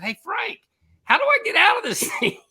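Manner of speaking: high-pitched holler for help; retold shout to someone ahead; calling ahead. Skier asking friend in chair ahead for help, calling out.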